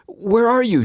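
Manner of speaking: very high, loud urgent demand; shouting. Retold or imitated. Sister on the phone demanding to know where he is, shouting. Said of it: "she shouted".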